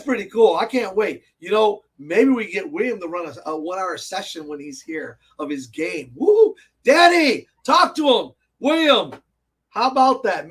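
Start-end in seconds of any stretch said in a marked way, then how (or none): 6.1-10.5 high, loud excited call; whoop, then call out loudly to them. Real, not acted. Host urging Danny to talk William into a game, calling out.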